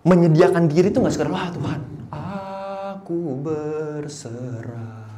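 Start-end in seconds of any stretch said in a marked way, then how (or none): 1.3-5.0 half-sung, very slow "aku berserah"; a lightly sung mock prayer; mock pious surrender. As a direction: croon a hammed-up holy surrender.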